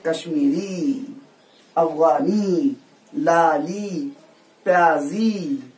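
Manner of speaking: slow listing. Shown as a demonstration. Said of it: "निस्बती है".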